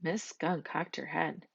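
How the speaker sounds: plain reading voice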